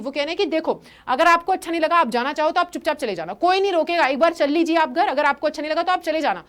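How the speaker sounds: ordinary delivery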